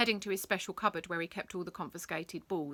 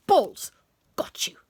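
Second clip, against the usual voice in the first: triumphant shout; 6 semitones higher, 12 dB louder than usual. Acted. Pouncing on ball.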